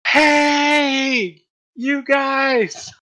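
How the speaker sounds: high, loud shout; yelling. Acted. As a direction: shout after the slowing caravan. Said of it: "she is yelling".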